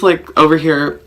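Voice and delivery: usual speaking voice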